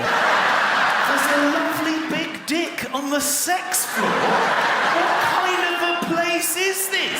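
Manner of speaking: mock outraged shout; shocked disbelief. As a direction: repeat it aghast. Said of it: "shocked echo".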